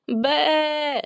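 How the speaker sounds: high startled burst; startled creature noise; startled bah. A narrator's reading. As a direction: pop out with a sound. Sparkle bursts out of pumpkin.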